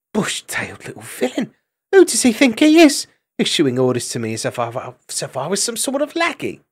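under-the-breath fury with sharp jumps; quietly seething. A narrator's reading. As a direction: fume to yourself, indignant. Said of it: "quietly seething".

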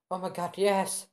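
high, loud thrilled cry; acting an overjoyed bride-to-be; excited acceptance. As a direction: squeal the yes to a proposal. Acted acceptance of marriage proposal.

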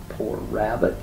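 ordinary delivery

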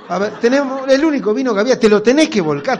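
loud exasperated scolding, laughing through it; mock scolding. Wife scolds husband for spilling the wine, exasperated.

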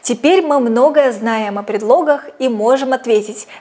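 ordinary talk, nothing marked

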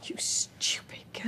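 slow, whispered rebuke; appalled whisper. Acted. Mother to daughter waiting on a taken boyfriend, appalled slow whisper. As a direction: whisper it slowly in disbelief.

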